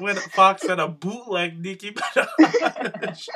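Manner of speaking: slow, laughing through the words; real giggly delivery, stretched out; cracking up. Real, not acted. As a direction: tell it while breaking into real laughter. Cracks up retelling the joke.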